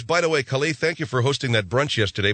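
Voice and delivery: ordinary delivery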